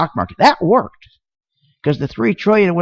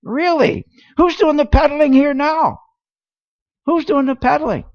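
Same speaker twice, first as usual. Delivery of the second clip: indignant outrage; 11 semitones higher than usual. Real, not acted. Indignant at politicians' claims.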